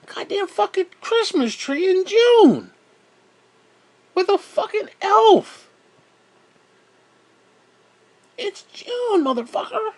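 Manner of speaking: high-pitched furious yelling; furious shout. Real, not acted. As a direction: scream it out in outrage. Ranting about Christmas in June.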